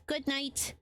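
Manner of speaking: usual speaking voice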